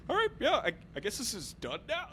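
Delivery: high, breezy dismissive voice; light mock therapist closing the session; therapist wrapping up. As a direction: brush them off like a bored therapist. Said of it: "and then they're like".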